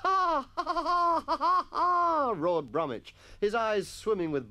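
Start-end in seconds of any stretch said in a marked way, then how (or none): 0.0-2.3 high, loud roar of fake mirth; hammed-up schoolboy guffaw; exaggerated laughter. A narrator's reading. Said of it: "exaggerated laughter".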